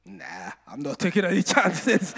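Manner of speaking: comic chickening-out, breaking into laughter; laughing refusal. Acted. Bullied boy decides to run away, laughing through the words. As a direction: crack up while backing out.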